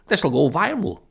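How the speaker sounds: loud, high excited shout; shouting onlooker. Acted. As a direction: shout it out while filming. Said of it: "one fella films it on his phone shouting".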